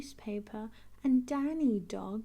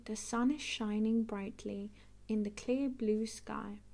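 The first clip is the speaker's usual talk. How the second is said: ordinary delivery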